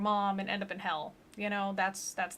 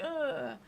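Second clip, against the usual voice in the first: devil's groan; 6 semitones higher, 3 dB quieter than usual. Retold or imitated. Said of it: "the devil's like".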